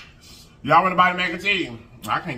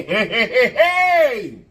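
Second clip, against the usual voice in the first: high, loud, drawn-out laughing heys; excited laughing chant; laughing hype; 6 semitones higher, 5 dB louder, much slower than usual. Real, not acted. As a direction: cheer it out slowly. Hyped over the meatloaf.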